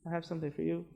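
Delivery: a hushed, hesitant offer at the door; shy neighbour barely offering the cure; timid offer. Retold or imitated. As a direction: mumble it apologetically. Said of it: "go to the door and say".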